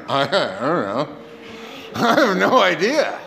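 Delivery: hesitant, mock-sheepish admission with a laugh; honest cluelessness. Congregant asked why we pray to God, sheepishly honest. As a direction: play someone owning up that they're stumped. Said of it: "maybe you're being honest and going".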